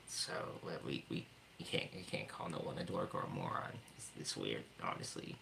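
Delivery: hushed aside whisper. Pretend. To chat, whispering the banned words, joking hush. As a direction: whisper it like the words are forbidden.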